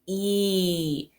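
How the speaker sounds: "E" held as a long ee. Shown as a demonstration. Teacher showing the long E sound. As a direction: stretch out a clear ee sound. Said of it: "they make the long E sound".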